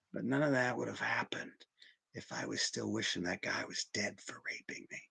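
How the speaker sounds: drops to a near whisper, barely voiced; hushed confession. Real, not acted. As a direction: let the hardest truth slip out almost unheard. Confession sinks to a hush.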